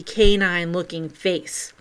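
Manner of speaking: ordinary delivery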